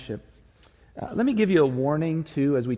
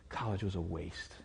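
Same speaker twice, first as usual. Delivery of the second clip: low, much softer, a touch of tears; tearful quiet; 8 semitones lower, 11 dB quieter than usual. Retold or imitated. Student confessing to his mentor, low and tearful. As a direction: let it drop out, broken and hushed. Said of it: "He just had tears flowing down his face".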